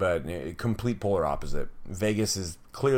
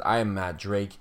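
usual speaking voice

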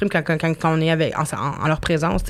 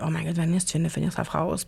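much softer, as if to herself; retold inner thought; 2 semitones lower, 8 dB quieter than usual. Recalling her blunder on the date, quietly scolding herself. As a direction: drop the volume for a private self-reproach.

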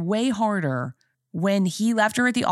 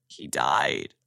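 much lower, choked mock sob; imitating sobbing; 4 dB quieter than usual. Answering her partner's question, imitating her own sobbing. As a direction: replay her blubbering answer to him. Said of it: "And I was sobbing".

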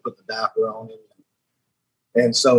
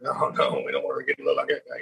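hushed, much lower aside, rushed; a lower mock whisper to yourself, hurried; whispering so the cousin won't hear; 10 semitones lower, 7 dB quieter, faster than usual. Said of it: "you're like".

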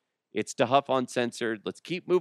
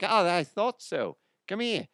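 put-on Australian accent; 7 semitones higher than usual. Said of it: "They're like".

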